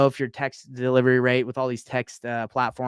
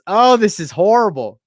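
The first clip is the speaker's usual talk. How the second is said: mock horror; 6 semitones higher, 9 dB louder than usual. Real, not acted. Reacting with dismay to text.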